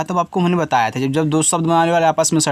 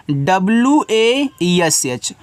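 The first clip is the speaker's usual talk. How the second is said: slow letter-by-letter spelling; each letter drawn out; much slower than usual. Shown as a demonstration. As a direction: spell it out slowly for the class. Teacher spells word by letters.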